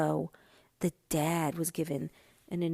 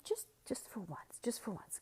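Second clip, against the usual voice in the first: hushed, urgent whisper; pleading whisper; 3 semitones higher, 12 dB quieter than usual. Retold or imitated. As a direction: beg him quietly not to make a scene.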